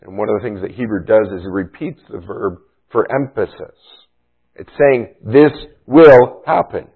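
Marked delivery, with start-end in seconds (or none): 5.2-6.9 "This will happen" slow and loud, hitting "will"; slow, weighty "This will happen" with a falling finish; emphatic. Shown as a demonstration. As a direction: lean hard on "will" in "This will happen". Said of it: "It's emphatic".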